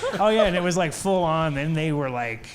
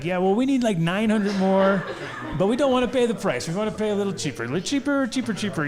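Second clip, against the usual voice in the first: high, pushy haggling voice, pitched well up; mock haggling buyers; 7 semitones higher, faster than usual. Russian buyers haggling the inventor down, high mock haggling voice. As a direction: bargain him down with a sly grin. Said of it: "they're like".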